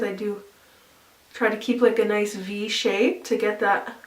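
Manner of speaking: normal talking voice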